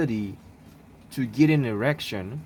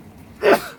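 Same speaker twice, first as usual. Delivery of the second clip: one sudden sharp sneeze; sneezing; 8 dB louder than usual. Real, not acted. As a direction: sneeze loudly mid-explanation. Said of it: "sorry about the sneezing".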